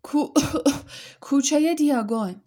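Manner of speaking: put-on a boy coughing on hot ash calls his destination. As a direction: cough it out mid-word. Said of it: "به سرفه افتاد".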